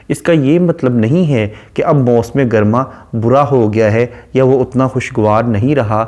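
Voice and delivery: normal talking voice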